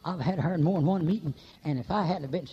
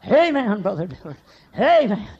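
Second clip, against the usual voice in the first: loud, high shouted amen, imitating Millard; mock amen shout; 4 semitones higher, 8 dB louder than usual. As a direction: belt out the amen like an old churchgoer.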